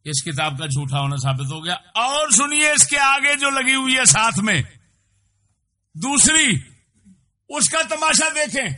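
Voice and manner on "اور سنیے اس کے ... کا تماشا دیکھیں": high, loud call to listen; booming sermon cry; fiery preaching. Acted. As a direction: rouse the crowd at full force. Preacher rises to a shout.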